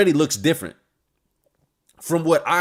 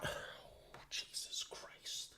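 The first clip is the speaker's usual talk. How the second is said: very soft, drawn-out whisper; stunned whisper; 25 dB quieter, much slower than usual. Real, not acted. Reader reacting to the fight, stunned whisper. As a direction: whisper it under your breath in disbelief.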